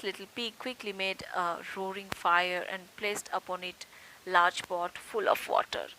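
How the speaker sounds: ordinary delivery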